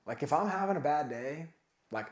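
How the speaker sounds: ordinary delivery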